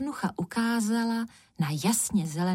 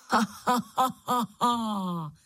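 higher, chortling wicked laugh; witch's cackle; 3 semitones higher, slower than usual. A narrator's reading. Witch laughing off Mikeš's demand, mocking cackle. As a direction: cackle in a run of mocking ha-has. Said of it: "zachechtala se čarodějnice".

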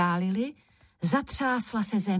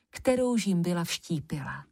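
plain reading voice

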